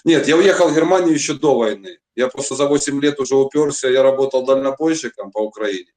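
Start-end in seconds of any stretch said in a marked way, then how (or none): none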